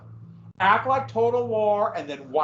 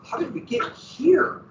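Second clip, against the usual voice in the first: baffled reader; 6 semitones higher than usual. Retold or imitated. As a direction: ask it like you just lost the plot. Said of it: "and you're going".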